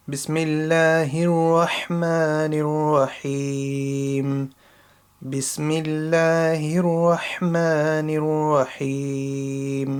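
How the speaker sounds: measured, melodic Quranic recitation. Shown as a demonstration. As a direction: recite it with full tajweed, letting each phrase ring. Slow recitation demo.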